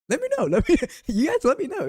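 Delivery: very high, bright call-out; squeaky high voice. Pretend. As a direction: pitch it way up.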